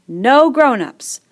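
slow, high, loud gleeful shout; delighted cry. A narrator's reading. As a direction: savour the joy of no adults. Ralph delighted, standing on head.

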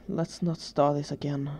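mild weary sigh, slightly subdued. A narrator's reading. Danny to his carers as a headache comes on, mildly weary. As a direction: say it with an understated, resigned sigh. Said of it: "he sighed".

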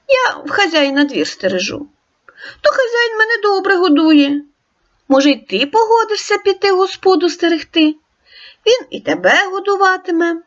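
storybook dog greeting the wolf; dog character. Acted. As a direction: ask like a curious friendly dog. Dog voiced in tale.

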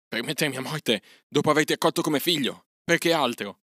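an aggrieved husband lashing out; snapping back. Acted. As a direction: bite back at her, wounded and furious. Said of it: "rispose di scatto l'uomo".

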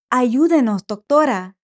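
desperate plea for help; pleading. Acted. Mother begs doctor for help.

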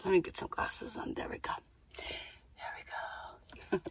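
softly whispered to herself; a breathy aside under her breath. Real, not acted. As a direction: whisper it while fumbling for glasses. Putting glasses on, aside.

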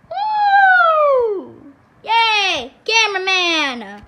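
high, loud whoop and yay; excited cheer. Real, not acted. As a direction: cheer for the cameraman. Cheering the cameraman.

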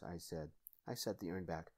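ordinary delivery, nothing marked

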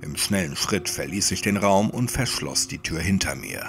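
plain reading voice

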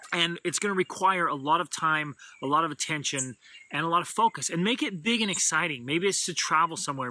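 normal talking voice

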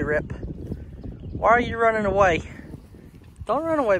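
ordinary talk, nothing marked

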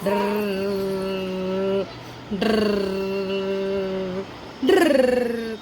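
long rolled drrr like a motor; drawn-out trilled engine sound; car engine noise. Pretend. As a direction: imitate a toy car driving. Imitating a toy car engine.

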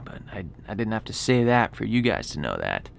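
whispered aside. Real, not acted. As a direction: drop to a whisper. Quiet aside after missing Mackenzie.

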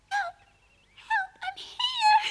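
crying for help. Acted.

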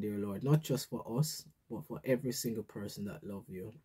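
soft, reverent prayer voice; hushed earnest prayer. Acted. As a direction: pray it humbly to the Lord. Opening prayer before the talk.

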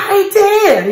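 high mock child yell; a boy's sulky protest; imitating a child's yell. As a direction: shout it like an upset little boy. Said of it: "à dire, euh".

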